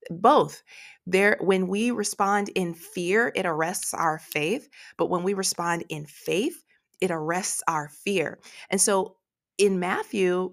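ordinary talk, nothing marked

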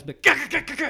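sharp high gah stabbing sounds; stabbing noises. Retold or imitated. Shiva acting out the stabbing for Kano, sharp noises. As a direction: jab out each gah like knife strikes.

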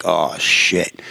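low, sinking mutter of dread; retold dismay. Husband hearing wife's bad news, retold dismay. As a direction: let it drop low, mock dismay.

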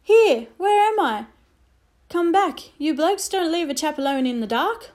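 high, loud calling of an English soldier; thin English soldier. A narrator's reading. Shut-in English soldier calls out to strangers, high and loud. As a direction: call out lost in the dark cupboard. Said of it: "a thin English voice from inside called".

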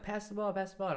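put-on high, excited kid voices begging for the ball; imitating children shouting to be passed to; kids calling out. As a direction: squeal it like eager little kids.

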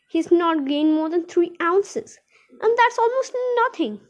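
an anxious tortoise owner pleading; fretting old lady. Acted. Miss Silver fretting over tortoise.

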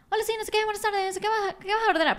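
high, sing-song Mexican cashier voice; Mexican service accent. Retold or imitated. Venezuelan mimics a Mexican cashier taking her order, high sing-song Mexican accent. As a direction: imitate the sweet Mexican cashier, pitched high. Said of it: "así con un acento".

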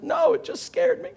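very high indignant kid imitation; mad child. Scared son answering dad's question, imitated indignant squeal. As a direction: mimic an upset son's high-pitched retort. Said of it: "he's so mad at me".

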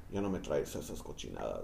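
quiet, laughing mock of his mother; lightly voiced annoyed mom; imitating mom. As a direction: mimic mom's scolding under your breath. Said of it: "She told me".

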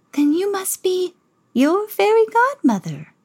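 lightly voiced high, slow godmother; a hint of a merry old fairy. Godmother greets Cinderella, lightly high and merry. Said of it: "the woman replied".

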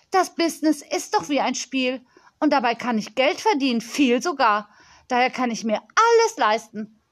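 put-on crisp, emphatic girl's voice; self-important young girl. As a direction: play a confident little girl showing off.

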